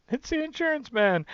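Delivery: high, loud squawky parrot echo; imitating the parrot. Listener repeats punchline.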